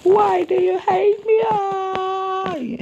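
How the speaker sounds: high, loud, hammed-up wailing; mock crying to God. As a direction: wail at God like a crybaby, way over the top. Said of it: "start crying and going".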